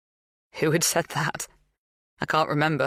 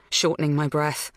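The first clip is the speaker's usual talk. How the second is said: usual speaking voice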